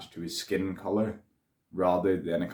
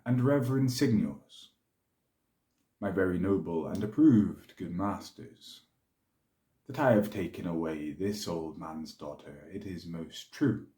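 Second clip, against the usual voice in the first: measured, dramatic Shakespearean delivery; stately verse speech, swelling and dropping with the tale; Othello. Acted. Othello defends his marriage before the senators, measured and theatrical.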